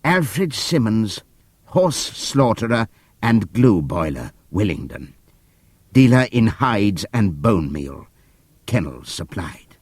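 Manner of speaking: slow, grave spelling out; donkey reading the van's lettering slowly. Acted. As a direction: read each word like a verdict. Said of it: "in the midst of a deadly silence he read".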